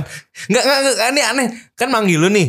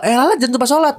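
put-on caring boyfriend reminder; 9 semitones higher than usual. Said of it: "Kalau ngatur dalam kebaikan sih nggak apa-apa".

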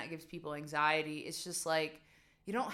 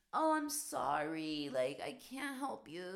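groveling apology; 2 semitones lower, slower than usual. Retold or imitated. Vlogger mimicking how she won't grovel to favor-askers, mock apologetic. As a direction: overdo the sorry with a sagging oh. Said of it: "I never will just be like".

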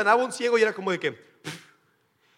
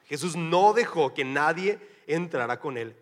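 ordinary talk, nothing marked

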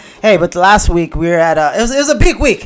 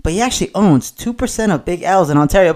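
usual speaking voice

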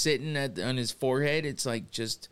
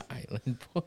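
laughing; 6 dB quieter than usual. Real, not acted. Laughing repeat of nickname.

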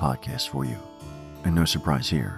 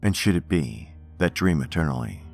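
poem reading. Acted. Reads Poe poems aloud.

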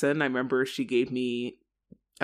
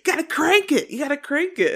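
high, loud imitation of an urgent mother; mock-frantic driving instructions; urgent yelling; 6 dB louder than usual. Mother telling a new driver to turn harder, urgent yelling.

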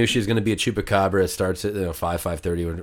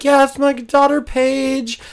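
very high mock-mom voice; high-pitched impression of a friend's mother; imitated mother; 4 dB louder, slower than usual. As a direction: squeal it as a proud mother, hammed up. Mother answers him in retold story.

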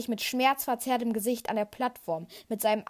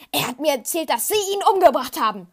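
loud, high, rising to a cry; desperate shout; 5 semitones higher, 9 dB louder than usual. A narrator's reading. Luke hurls the accusation at Vader, shouting. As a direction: accuse him at full voice. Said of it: "antwortet Luke verzweifelt".

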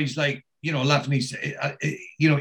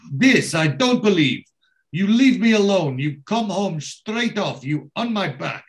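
high, loud scolding that fades at the end; angry outburst; 5 semitones higher, 4 dB louder than usual. Retold or imitated. Wife berates her husband for neglecting her, angry. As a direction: tear into him for leaving her alone. Henpecked Barry gets shouted at.

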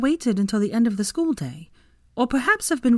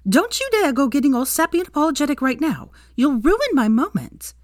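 firm warning; 9 semitones higher, 4 dB louder than usual. A narrator's reading. Hermione stops Draco's apology.

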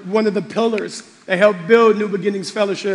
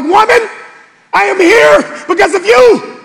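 high, loud call of thanks; loud grateful cry; 11 semitones higher, 8 dB louder than usual. Retold or imitated. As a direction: cry it out to her. Pastor addressing Grandma Martha.

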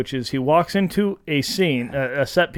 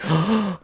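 mock shocked intake of breath; retold gasp of astonishment; 3 semitones higher, 4 dB quieter than usual. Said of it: "Oh, I was like".

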